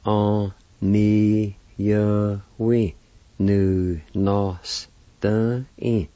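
"on ni ya we nu nos tan in" drawn out very slowly, each syllable separate. Shown as a demonstration. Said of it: "And together".

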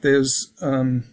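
usual speaking voice

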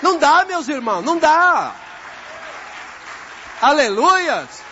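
loud, high, drawn-out proclamation; full-force preaching. Real, not acted. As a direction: preach it out to the whole congregation, stretching key words.

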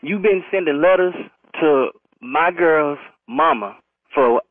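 ordinary talk

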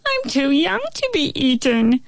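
high whiny bug voice; fly voice. A narrator's reading. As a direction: whine it like a scared little bug.

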